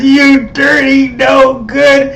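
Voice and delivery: high, loud, slow landlady's scolding; retold outraged landlady. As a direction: drag out the insult like a shocked old lady. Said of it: "she goes".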